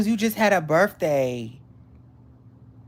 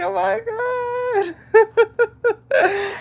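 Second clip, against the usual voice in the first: high, loud, laughing squeal of joy; real giddy laughter; 4 dB louder, much slower than usual. Real, not acted. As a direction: gush with real happiness, laughing. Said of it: "I can't stop smiling".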